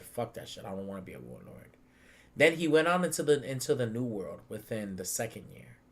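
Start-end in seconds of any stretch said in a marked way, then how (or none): 0.0-1.8 hushed. Retold or imitated. Voicing Ace refusing.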